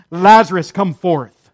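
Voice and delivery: loud, ringing command; big burst on the name; commanding call. Acted. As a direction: call out forcefully, summoning someone from afar. Acting Jesus calling the dead.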